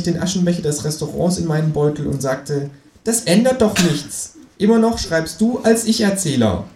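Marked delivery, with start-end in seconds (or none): 3.0-6.6 smug kangaroo, higher than the narrator; cocky know-it-all kangaroo; Känguru voice. Acted. Känguru figure voice. (selbstgefälliges Känguru, höher gesprochen)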